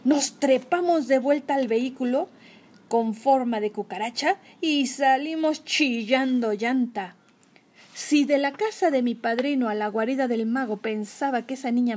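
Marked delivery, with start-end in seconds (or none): none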